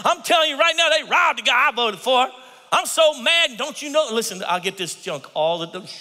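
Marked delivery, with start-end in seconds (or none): 2.7-4.1 hammed-up high outraged rant; mock outraged congregant. Preacher mocking congregants, high and loud.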